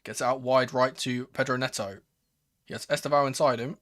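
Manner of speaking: ordinary delivery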